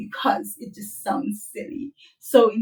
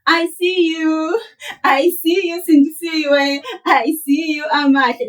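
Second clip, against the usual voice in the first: higher, louder, overdone greeting; mock literal greeting; 6 semitones higher, 5 dB louder than usual. Teacher mocking a literal greeting to friends, hammed up. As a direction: ham up a silly word-for-word hello. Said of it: "we don't walk around and saying".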